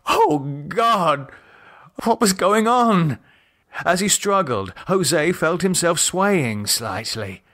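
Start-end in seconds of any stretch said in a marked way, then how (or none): 0.1-3.2 a man waking up bound, starting to panic; rising panic. Acted. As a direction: voice climbing higher in alarm. Bound captive panics in thought.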